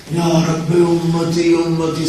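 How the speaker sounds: slow, soft yearning plea; soft pleading. Acted. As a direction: let the plea ache softly. Prophet pleading for ummah.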